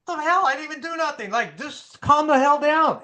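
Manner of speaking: high-pitched hammed-up protest; mock indignant retort. Husband retorting when wife gets mad, mock indignation. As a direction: act out an exasperated, defensive husband. Old self reacting to wife's anger.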